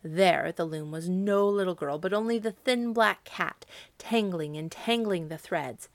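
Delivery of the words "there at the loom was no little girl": ordinary delivery, nothing marked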